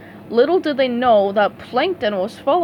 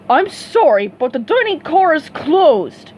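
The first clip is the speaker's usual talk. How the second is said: snooty, louder waiter voice; 5 semitones higher, 7 dB louder than usual. A narrator's reading. Rude dining car waiter turning them away, snooty. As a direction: look down your nose at them. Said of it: "he said in a snooty way".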